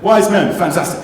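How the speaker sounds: high, loud enthusiastic echo; excited praise. Real, not acted. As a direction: cheer the answer back. Teacher echoes answer with praise.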